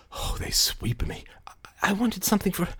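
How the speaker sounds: breathy hurried whispered confession; ashamed whisper. Acted. Kay whispers her confession to brother Larry. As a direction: whisper it out guiltily.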